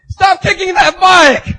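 loud, drawn-out acted shout; angry stranger's yell. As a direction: yell at someone wrecking your property. Angry stranger yells from behind.

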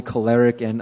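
normal talking voice

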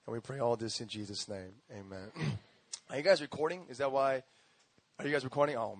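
ordinary delivery

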